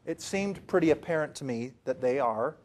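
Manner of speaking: normal talking voice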